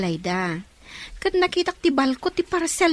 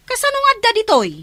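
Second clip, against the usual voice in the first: sharp, raised and quick; loud suspicious question; alarmed demand; 9 semitones higher, 9 dB louder, faster than usual. Acted. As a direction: confront her about the parcel. Suspicious confrontation over parcel.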